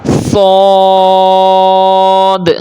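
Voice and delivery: صاد held very long with a heavy, rounded vowel; sharp s opening into a long, thick صاد; thick whistled s. Shown as a demonstration. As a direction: recite صاد full-mouthed on a steady note. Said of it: "سیٹی کی آواز نکالنی ہے".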